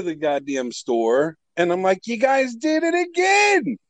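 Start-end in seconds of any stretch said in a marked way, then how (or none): 2.1-3.8 high, drawn-out accusing cry; retold indignant shout. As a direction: call them out, slow and high-pitched. Acting out confronting the clerks.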